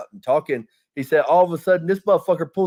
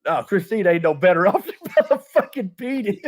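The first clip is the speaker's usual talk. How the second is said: higher, laughing through the words; laughing punchline; 5 semitones higher than usual. Retold or imitated. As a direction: crack up while delivering the joke.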